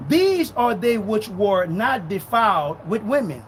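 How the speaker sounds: slow, emphatic scripture reading. Real, not acted. Preacher reading Revelation to doubters, slow and emphatic. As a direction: read it slow, driving each phrase home. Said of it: "I'll read it slow".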